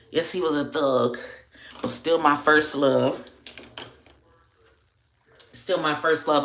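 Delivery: normal talking voice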